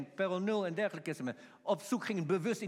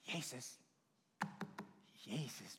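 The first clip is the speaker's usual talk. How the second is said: soft, low, timid mock call; meek little call; 7 semitones lower, 13 dB quieter, slower than usual. Preacher showing how the man surely didn't call, meek and soft. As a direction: barely call the name, far too quietly.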